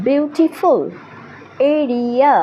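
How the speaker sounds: "Beautiful", "area" drawn out syllable by syllable; তিন সিলেবলে ভাগ. Shown as a demonstration. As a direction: sound out "beautiful" and "area" in three even, stretched beats. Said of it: "তিনটি সিলেবলে ভাগ করে". ("Beautiful", "area" তিন ভাগে টেনে টেনে উচ্চারণ)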